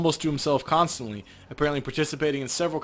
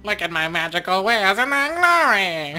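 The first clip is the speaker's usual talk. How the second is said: mock troll merchant; 6 semitones higher, 5 dB louder than usual. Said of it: "almost exactly like the character that Surrounded would".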